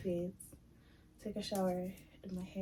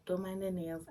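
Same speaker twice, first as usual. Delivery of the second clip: normal talking voice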